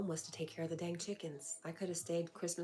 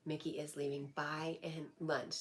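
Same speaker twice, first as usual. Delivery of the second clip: ordinary talk, nothing marked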